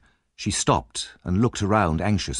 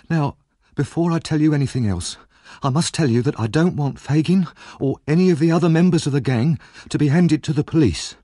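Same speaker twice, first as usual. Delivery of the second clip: put-on higher, anxious young woman's voice; Nancy, tense and fearful, pitched up; frightened young Nancy; 8 semitones higher, 4 dB louder than usual. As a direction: voice the scared girl on edge. Nancy's voice held in reading.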